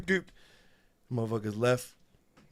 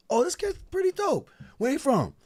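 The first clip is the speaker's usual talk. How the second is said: much higher excited mock voice; impressed industry people. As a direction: play a hyped listener, pitched way up. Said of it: "they'd be like".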